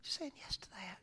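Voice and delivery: even fainter whisper; barely breathed. Shown as a demonstration. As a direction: let it fade to almost nothing. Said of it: "repeated softer whisper".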